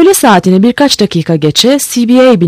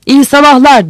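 higher-pitched shouted greeting, moderately loud; raised narrator's shout; called-out shout; 7 semitones higher, 3 dB louder than usual. A narrator's reading. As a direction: call out a greeting across the water. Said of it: "diye bağırdı".

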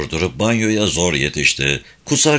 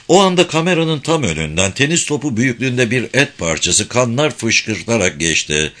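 ordinary delivery, nothing marked

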